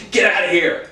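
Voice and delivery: mock outrage with a laugh; mock angry dismissal. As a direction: mimic the mad bystander while amused. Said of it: "angry, mad".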